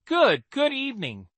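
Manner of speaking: nervous stammering greeting; awkward stammer. Acted. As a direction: stumble over the greeting sheepishly. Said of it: "let out an awkward chuckle".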